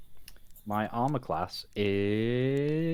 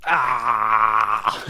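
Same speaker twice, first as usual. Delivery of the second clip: triumphant yell; 7 dB louder than usual. Acted. Breaking free of grapple.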